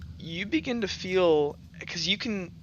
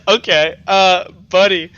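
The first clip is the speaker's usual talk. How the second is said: laughing; 3 semitones higher, 14 dB louder than usual. Real, not acted. GM laughs at lie.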